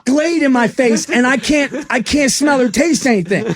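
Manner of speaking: high, loud, laughing, stretched-out plea; frantic laughing plea. Retold or imitated. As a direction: plead the case in manic laughter. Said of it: "I go".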